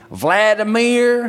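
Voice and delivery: Southern drawl, high and loud, drawn way out; East Tennessee accent. Retold or imitated. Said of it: "they called me".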